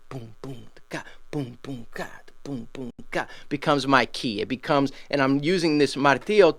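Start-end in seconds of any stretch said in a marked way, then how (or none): 0.1-3.3 whispered vocal beat. Shown as a demonstration. Teacher to student, barely voicing the clave rhythm. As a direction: boom ka almost breathed. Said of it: "that rhythm".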